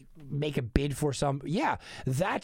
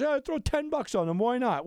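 higher, lightly mimicked easygoing bettor; casual bettor; 10 semitones higher than usual. Guy talking someone into a ten-dollar bet, lightly mimicked. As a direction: toss it off as a lightly imitated punter. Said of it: "being the guy that goes".

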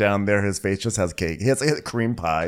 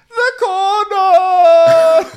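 very high, loud, stretched-out cry; mock wail; 11 dB louder, much slower than usual. [name] answering which step, long high mock wail. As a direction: howl each word in exaggerated agony.

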